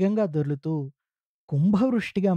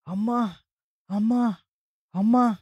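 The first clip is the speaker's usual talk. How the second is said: rising anguished calls for mother, getting louder; sudden loud cries; 6 semitones higher than usual. A narrator's reading. Sick man suddenly crying out for his mother, loud anguished calls. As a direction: cry out Amma, each call higher and stronger. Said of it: "పెద్దగా కేకలు పెట్టారు".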